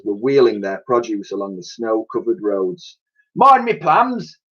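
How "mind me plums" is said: high slow shouted cry; cockney stallholder shout. Acted. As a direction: yell after your trampled fruit. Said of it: "shouted one".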